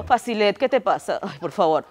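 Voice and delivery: imitating Yasemin. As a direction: sneer it like a spoiled rival. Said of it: "Voy de nuevo".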